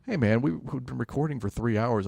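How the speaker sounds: normal talking voice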